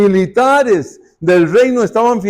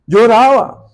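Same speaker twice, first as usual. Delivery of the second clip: laughing; 5 semitones higher, 6 dB louder than usual. Real, not acted. Laughing at crying Moses.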